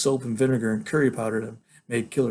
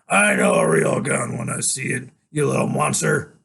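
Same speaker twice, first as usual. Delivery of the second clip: villain's sneer; 6 dB louder than usual. Acted. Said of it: "he shakes his head and goes".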